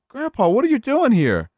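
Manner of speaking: mock alarmed relative; shocked family. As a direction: gasp it out at a ghost.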